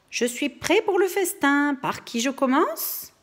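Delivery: a hint of a hungry wolf; mild growl. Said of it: "grogna le loup".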